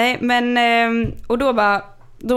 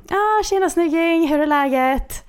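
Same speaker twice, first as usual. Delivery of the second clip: high, drawn-out mock greeting; stretched, sing-out hello imitating a caller; imitated caller's greeting; 8 semitones higher, slower than usual. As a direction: greet like an over-familiar caller, slow and high. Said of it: "Så ringer han upp lite senare och bara".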